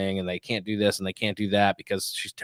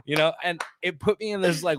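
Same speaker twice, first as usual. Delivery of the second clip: ordinary talk, nothing marked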